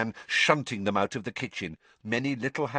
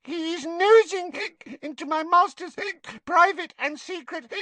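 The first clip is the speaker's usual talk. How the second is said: high, tipsy house-elf voice fiercely loyal; Winky the house elf; 4 dB louder than usual. Acted. Drunk house elf defending her master to Harry. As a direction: squeak out her loyalty, slurring a little.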